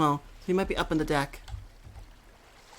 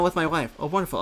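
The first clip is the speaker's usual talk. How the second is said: usual speaking voice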